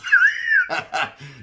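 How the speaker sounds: squealing high burst of laughter. Real, not acted. To the listeners after his own punchline, a high squealing laugh. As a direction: cackle high at your own joke.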